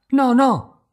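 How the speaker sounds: scared bride warding off her husband; urgent no. Acted. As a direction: stop him at once. She keeps him away.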